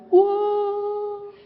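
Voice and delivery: high, sung mock whoa. Newcomers spiralling down the drain, a high sung whoa. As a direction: sing it out like someone sliding down the drain. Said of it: "they're like".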